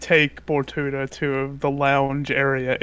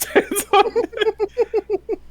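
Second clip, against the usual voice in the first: high character voice; much slower than usual. Acted. Player voices her static-charged character.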